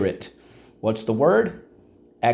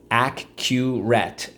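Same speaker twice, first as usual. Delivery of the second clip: Accurate drawn out slowly; teacher stretches Accurate for class, slowly; slow model; much slower than usual. Shown as a demonstration. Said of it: "repeat drill".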